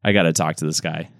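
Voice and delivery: usual speaking voice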